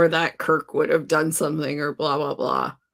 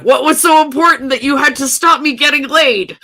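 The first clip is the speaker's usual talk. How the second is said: indignant complaint; 7 semitones higher, 5 dB louder than usual. Retold or imitated. Pike annoyed at being called away.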